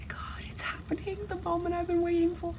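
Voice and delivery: high whispered excitement; breathless fangirling. Real, not acted. Reader thrilled the romance moment has come, high breathless whisper. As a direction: gasp it out, barely containing joy.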